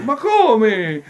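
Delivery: loud, high, outraged protest; full-voiced high outburst; indignant shout. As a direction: shout it in exasperated disbelief. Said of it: "Gridò, gridò".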